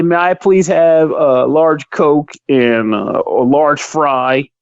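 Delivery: a mild, slowed-down show of good manners; polite order. Retold or imitated. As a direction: order like the nicest customer alive. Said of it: "look the guy in the eye and say".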